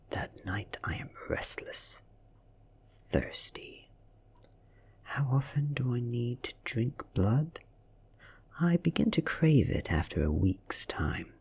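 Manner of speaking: plain reading voice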